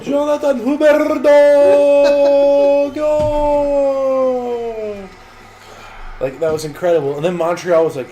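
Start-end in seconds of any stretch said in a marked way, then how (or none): 0.0-5.1 slow, drawn-out high goal call; soccer goal call. Retold or imitated. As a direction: stretch the goal call out, rolling every R. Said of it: "He rolled every R he could fucking find".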